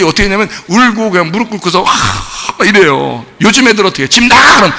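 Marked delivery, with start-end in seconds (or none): 4.1-4.6 imitated angry order; mock parent's yell. Parent to today's kids, mock yelling. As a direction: act a parent throwing the kid out.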